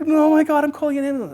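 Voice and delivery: high, loud mock panic; panicked. PA deciding to phone for help, acted panicked cry. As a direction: imitate an alarmed helper raising the alarm.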